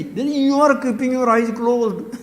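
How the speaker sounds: put-on child scolding grandpa. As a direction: explain it like a know-it-all five-year-old. Voicing the small child.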